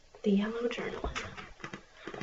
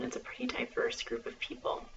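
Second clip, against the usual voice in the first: library whisper; 3 semitones higher, 7 dB quieter than usual. Acted.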